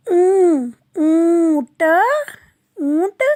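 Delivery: ऊ then ऊंट, nasal and emphasized; nasal vowel demo. Shown as a demonstration. Teacher to children, nasal demo. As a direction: push ऊ through the nose. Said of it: "तो कैसे बोलेंगे इसको?".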